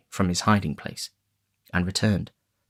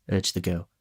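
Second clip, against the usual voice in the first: ordinary delivery, nothing marked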